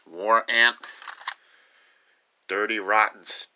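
ordinary talk

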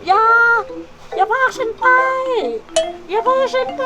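high squeaky plea for the hamster; pleading hamster voice. Pretend. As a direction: voice the hamster begging not to go.

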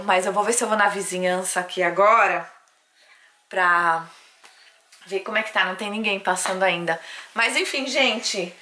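normal talking voice

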